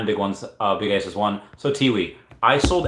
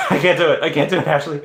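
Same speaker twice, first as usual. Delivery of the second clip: giving up through laughter; amused, higher-pitched protest; laughing; 4 semitones higher, 4 dB louder, faster than usual. Real, not acted. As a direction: crack up mid-sentence. Cracks up after failed auction.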